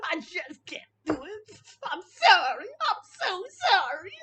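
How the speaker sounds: loud, high, hammed-up blubbering; whiny mock crying. Commentator making fun of a streamer's breakdown, whiny fake crying. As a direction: whine and sob like an overgrown child. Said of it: "talking in his whiny voice".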